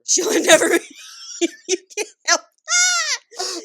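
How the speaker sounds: high, loud, breaking into a squeal; helpless laughter. Real, not acted. Storyteller derailed by her own running joke, helpless laughter. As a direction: crack up before you can finish the line. Cracks up at repeated catchphrase.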